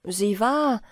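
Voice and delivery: ordinary delivery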